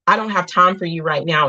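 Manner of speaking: ordinary talk, nothing marked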